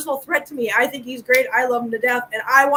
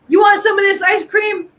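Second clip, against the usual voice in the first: retold call across the street; 6 semitones higher, 4 dB louder than usual. Homeless man calling across street.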